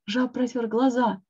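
ordinary delivery, nothing marked

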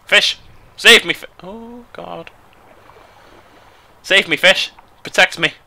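high, loud cries for rescue; hammed-up damsel-in-danger pleading; mock plea for help. Player begging fish to save them, mock plea.

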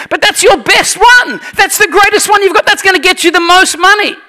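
high-pitched, disbelieving objection; hammed-up outraged disbelief; incredulous protest. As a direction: plead against an absurd waste. Acting out protest at sacrificing best cow.

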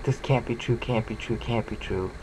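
murmured mock disbelief fading out. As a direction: let the disbelief trail away quietly. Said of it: "the marveling at that".

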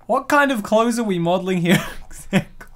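ordinary talk, nothing marked

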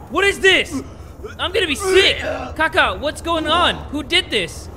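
real panic at a gory scene; freaked out. Real, not acted. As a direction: yell like you might throw up. Horror game gore scene.

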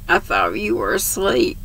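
softened, understated tearful reply; subdued narrated sobbing; mild sob. A narrator's reading. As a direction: say it quietly, with only a hint of tears. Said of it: "sobbed Beth".

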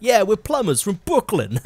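slow, high mock Brooklyn accent; bad Brooklyn accent. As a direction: ham up a Brooklyn accent as a cartoon plumber. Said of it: "You can't do a Brooklyn accent".

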